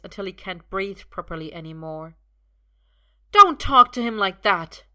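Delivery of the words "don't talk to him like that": loud, higher fierce defence; fiercely shield Arthur; loud fierce rebuke. A narrator's reading. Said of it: "Merlin says fiercely".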